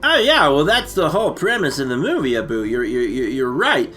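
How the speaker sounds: ordinary talk, nothing marked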